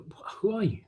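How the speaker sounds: high, stumbling, insistent questions; bewildered demand. Retold or imitated. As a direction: fire baffled questions at a stranger. Demands the stranger explain the favour.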